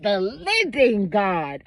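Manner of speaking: "living" stretched out very slowly; emphatic living God. Real, not acted. Stressing which God she serves, slow emphatic "living". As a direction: put weight on "living", dragging it out. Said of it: "I gotta put emphasis on that".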